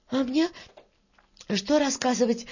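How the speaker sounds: put-on high, light little-girl voice; Varya, hurried. Varya answers the old worker, eager. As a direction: pitch up and keep it small. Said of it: "заспешила Варя".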